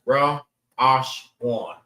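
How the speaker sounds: Ra, Ash, Wan, each sounded apart; teacher sounding out Ra, Ash, Wan piece by piece; three separate sounds. Shown as a demonstration. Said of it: "Three different sounds".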